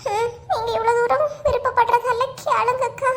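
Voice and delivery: high, pitiful mock consent; fake tearful reluctance. Letting the neighbour go ask, fake tearful reluctance. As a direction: give in with put-on tears. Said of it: "என்னமா நடிப்பு நடிக்கலுவ".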